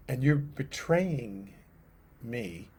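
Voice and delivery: slow and hushed, dropping to almost nothing on "me"; slow, sorrowful. Acted. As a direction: draw it out softly, heartbroken. Jesus pleading with Judas.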